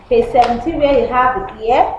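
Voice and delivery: normal talking voice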